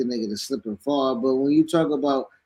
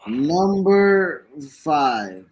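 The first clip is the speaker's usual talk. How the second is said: stoner; 3 semitones lower than usual. Real, not acted. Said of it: "I'm high right now".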